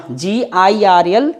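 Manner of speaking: slow letter-by-letter जीआईआरएल; spelling out G-I-R-L. Shown as a demonstration. Teacher spelling a word for students, slow and clear. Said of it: "गर्ल हो गया".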